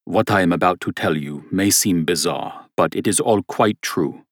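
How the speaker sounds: put-on grave, earnest German-accented opening; serious German accent. Rausch beginning his story to the priest, serious German accent. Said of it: "said a man with a thick German accent".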